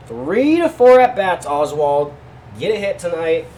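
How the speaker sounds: high, loud hype; hyped up. Real, not acted. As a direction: pump up the player. Hyping up the pick.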